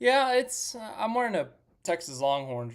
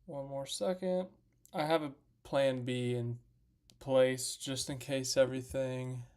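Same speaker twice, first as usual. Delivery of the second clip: normal talking voice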